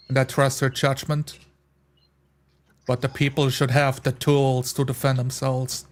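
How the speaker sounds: ordinary talk, nothing marked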